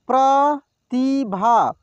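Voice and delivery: Pratibha drawn out slowly, syllable by syllable; slow sounding out. Shown as a demonstration. Teacher slowly sounds out Pratibha for students. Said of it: "एक एक मात्रा को अलग अलग करके".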